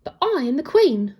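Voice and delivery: disbelieving queen, voice rising; incredulous. A narrator's reading. Queen refusing the charge against her, incredulous and loud. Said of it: "The lady was incredulous".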